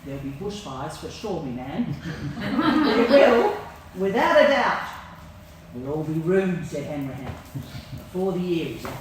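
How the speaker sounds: a hint of a doom-saying farmer; lightly voiced grumbling old man; gloomy old bushman. Voices Hanrahan in the poem.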